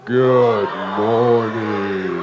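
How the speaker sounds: very low, drawn-out sung greeting; deep voice. Shown as a demonstration. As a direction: sing it slow and deep down low. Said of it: "we going with a deep voice".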